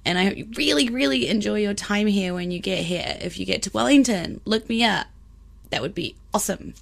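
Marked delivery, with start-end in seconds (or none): none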